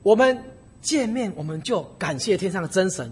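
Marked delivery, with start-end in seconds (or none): none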